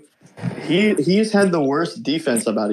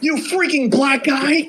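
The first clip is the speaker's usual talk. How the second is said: high, slowed mock kid voice; meme kid voice; 10 semitones higher, slower than usual. Quoting a viral meme kid to his friend, high mock voice. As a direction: ham up the indignant kid.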